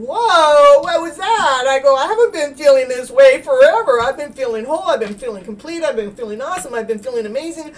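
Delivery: loud, high exclaiming to herself; a startled, bright outburst; excited outburst. Retold or imitated. Healer reliving her shock at the feeling, loud and excited. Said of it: "I'm going".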